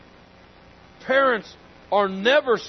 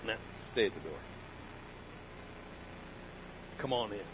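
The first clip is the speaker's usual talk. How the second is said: much softer, low-key voice; hushed aside; 12 semitones lower, 17 dB quieter, much faster than usual. Acted. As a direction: murmur it like a private instruction. Imagined Jesus at door.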